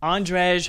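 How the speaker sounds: "Andrej" read loudly as ANDREZH; name attempt. Real, not acted. Reading a supporter's name aloud, unsure pronunciation. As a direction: try "Andrej" out loud as on-dresh. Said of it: "however you say your name".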